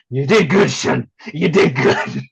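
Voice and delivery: loud, hammed-up fatherly praise; movie scene impression. Wrestler answers breaking opponent with Ridiculous Six scene, hammed-up impression. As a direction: put on a film character's proud-dad voice. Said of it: "I did that one scene".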